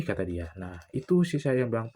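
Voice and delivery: ordinary talk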